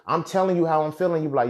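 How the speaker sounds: ordinary delivery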